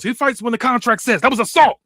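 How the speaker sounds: high, loud indignant yell. Acted. Ruby protesting the attack, loud high yell. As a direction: shout the protest at the ring. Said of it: "Ruby yells".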